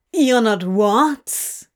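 slow, loud mock puzzlement; exaggerated confusion. A narrator's reading. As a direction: play dumb on purpose. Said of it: "asked with exaggerated confusion".

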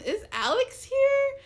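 high, drawn-out timid question; tentative asking. Retold or imitated. Customer asking staff after her crush, tentative. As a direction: ask slowly in a small high voice.

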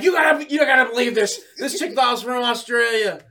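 loud, high, incredulous retelling; excited announcing. Retold or imitated. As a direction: shout the big news, reliving the excitement. Retold telling everybody at work.

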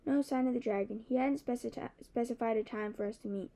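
ordinary delivery, nothing marked

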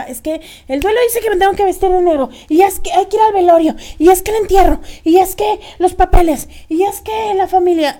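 fed-up rant about funeral duties; exasperated list. Real, not acted. Listing grief rituals in exasperation.

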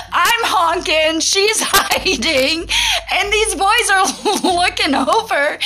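laughing hard, high and slowed as she tells it; real laughter breaking into the words; laughing through words. Real, not acted. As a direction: giggle the story out, barely holding it together. Laughing while retelling the honking.

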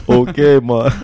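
put-on laughing, joking Jamaican accent; a hint of put-on Jamaican; mock Jamaican. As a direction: play along in a light Jamaican lilt.

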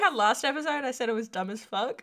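giggling through the words; laughing. Real, not acted. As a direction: laugh while you talk. Laughing at own confusion.

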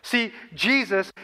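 ordinary talk

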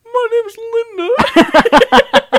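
very high, slow, strained falsetto; a burly dwarf faking a dainty voice; hammed-up squeaky lady voice; falsetto dwarf woman. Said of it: "the most masculine voice ... make it high pitched".

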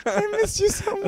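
high, hammed-up weepy whine; mock crying. Teasing a friend, with a hammed-up falsetto sob. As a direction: fake a sob through the words.

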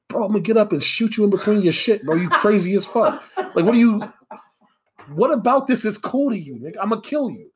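high, loud angry rant; heated rant. Real, not acted. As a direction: rant with rising heat.

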